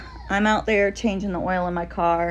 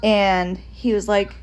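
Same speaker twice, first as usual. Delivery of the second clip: ordinary talk, nothing marked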